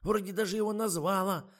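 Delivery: высокий, громкий, напевный старушечий голос; тянет слова нараспев; напевно. A narrator's reading. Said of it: "запела Ада Марковна". (высокий напевный старушечий голос)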